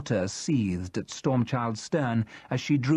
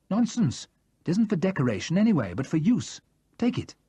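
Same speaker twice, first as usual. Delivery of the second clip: higher, brisk and fast, brushing off protest; a firm, kindly insistence; brisk insistent older man; 7 semitones higher than usual. Acted. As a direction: wave away the refusal. David insists she take book.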